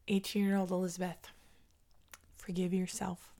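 hushed, soft, with long pauses; tender self-forgiveness. Real, not acted. Woman telling her eighteen-year-old self to forgive, tender and hushed. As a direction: speak gently, almost under the breath.

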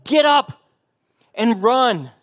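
high, loud urgent plea; Paul pleading. Retold or imitated. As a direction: urge lagging runners to get back in the race. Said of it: "He's saying".

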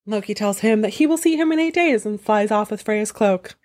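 ordinary talk

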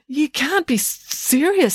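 high squeaky stammered disbelief; alarmed disbelief. Acted. Joe alarmed.

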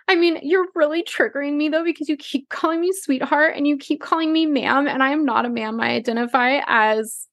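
high, prissy mock complaint; hammed-up indignant girl voice; mock offended girl. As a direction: mimic her taking offense. Mimicking girl, odd timbre.

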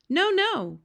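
very high, loud denial; protesting cry. A narrator's reading. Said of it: "cried the B F G".

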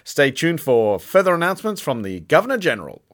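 high, loud urgent bulletin; an excited newsreader breaking a scandal; breaking news. Acted. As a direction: announce it like a hot scoop.

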